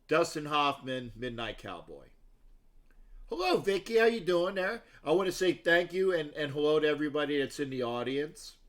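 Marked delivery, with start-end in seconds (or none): none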